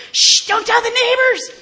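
high, loud laughing mock shush; mock family shushing. Mocking her hushing relatives in a high loud laugh. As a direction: hush everyone up with a laughing high squeal. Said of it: "everybody's going".

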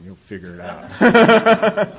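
laughing punchline. Real, not acted. Wedding ring joke to the group, slow laughing punchline. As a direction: deliver the joke while cracking up.

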